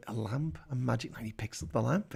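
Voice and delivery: ordinary talk, nothing marked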